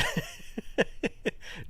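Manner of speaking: high, breathy, voiceless chuckle; a real, airy burst of laughter; amused laugh. Real, not acted. Amused at too many channels.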